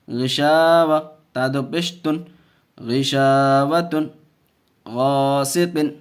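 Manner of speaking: slow chant with long-held vowels; measured teaching recitation; Qaida spelling recitation. Shown as a demonstration. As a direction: stretch the long syllable, keep short ones brief.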